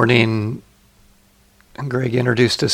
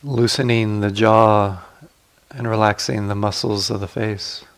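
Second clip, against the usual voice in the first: guided meditation. Acted. Teacher guiding sitters to relax the body, calm guided meditation.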